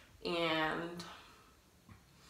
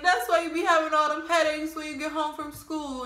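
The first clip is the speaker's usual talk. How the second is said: mom's outburst; 8 semitones higher, 5 dB louder than usual. Retold or imitated. Re-enacting mom's tearful outburst.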